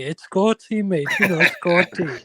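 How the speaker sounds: teasing Welsh impression; Scotty. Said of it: "I don't speak like Scotty".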